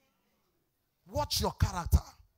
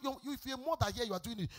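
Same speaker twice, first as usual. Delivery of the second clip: ordinary delivery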